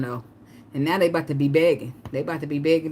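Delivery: ordinary talk, nothing marked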